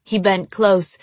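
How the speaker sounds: plain reading voice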